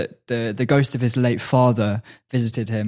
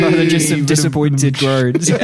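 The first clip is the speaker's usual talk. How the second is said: laughing; 3 semitones higher, 4 dB louder than usual. Real, not acted. Laughing while speaking.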